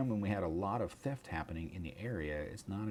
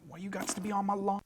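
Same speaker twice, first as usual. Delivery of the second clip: dog voice; 12 semitones higher than usual. Retold or imitated. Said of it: "the dog is like".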